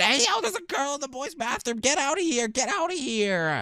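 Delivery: high-pitched outraged alarm; shocked outcry. Pretend. Player ordering a girl out of the boys' bathroom, shocked. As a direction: call out high, shooing someone away.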